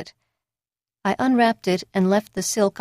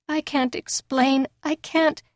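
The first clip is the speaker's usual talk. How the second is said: higher, slow, choked refusal; tearful; 8 semitones higher, slower than usual. Acted. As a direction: near tears, slow. Distressed refusal.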